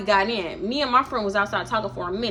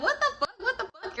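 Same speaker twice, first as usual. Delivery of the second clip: high, laughing what-the-fuck; shocked laugh at a dumb remark; laughing disbelief; 12 semitones higher, 7 dB quieter than usual. Retold or imitated. The friends reacting to Darnisha, in high, laughing disbelief.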